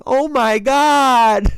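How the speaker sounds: very slow, high, loud drawn-out "Oh, my God"; laughing hammed-up impression; mock Cat exclamation. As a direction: stretch it out, imitating him. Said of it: "of Cat driving and saying".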